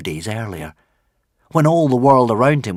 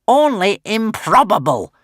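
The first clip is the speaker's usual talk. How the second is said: higher, louder, world-weary witch voice; a scheming witch unimpressed; witch sighing; 10 semitones higher, 5 dB louder than usual. Acted. Witch correcting Alvin, weary witchy sigh. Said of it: "sighed the witch".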